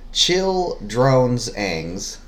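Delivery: word by word, spaced out evenly; deliberate clue reading. Shown as a demonstration. Reading a puzzle clue the partner must decode, slowly word by word.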